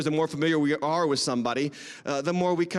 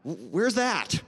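mock bafflement; 3 semitones lower, slower than usual. Plays himself baffled by visitor.

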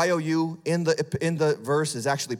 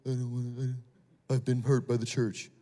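whiny churchgoer; 5 semitones lower, 5 dB quieter than usual. Retold or imitated. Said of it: "some of you say".

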